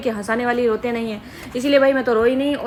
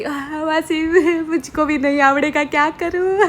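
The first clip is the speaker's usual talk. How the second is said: a hammed-up fake whimper, higher in pitch; fake crying; 4 semitones higher, slower than usual. Whining fake sobs at her aunt about leaving. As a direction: pretend to be heartbroken, badly faked. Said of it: "झूठ मूठ ऐसा रोने रोना पड़ा".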